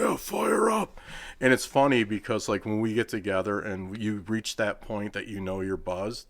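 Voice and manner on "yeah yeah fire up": pitched way up, hyped wrestler voice; Jamie Cox impression. Imitating the wrestler's catchphrase for friends, higher hype voice. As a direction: pump it up like the wrestler. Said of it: "he talks like this".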